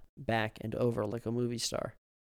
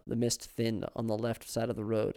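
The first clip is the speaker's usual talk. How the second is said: plain reading voice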